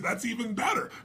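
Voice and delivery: surprised and pleased, lifting at the end; tickled reply to the boy taking his mom to lunch; delighted. Retold or imitated.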